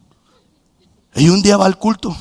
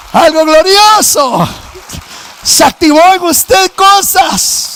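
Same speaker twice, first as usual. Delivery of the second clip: preaching at full force; 13 semitones higher, 7 dB louder, slower than usual. Real, not acted.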